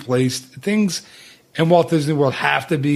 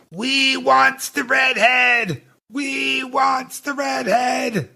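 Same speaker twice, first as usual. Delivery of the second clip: high, very slow hammed-up pirate bellow; a pirate auctioneer, high and drawn out, cheering at the sale; 10 semitones higher, much slower than usual. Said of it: "I can't really do the voice, but I wanna try".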